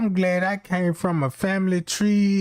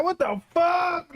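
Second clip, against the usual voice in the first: high, slow, horrified exclamation; drawn-out horrified what-the-hell; freaked-out yelp; 10 semitones higher, slower than usual. Retold or imitated. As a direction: recoil in shock as you say it. Startled reaction, cry heard.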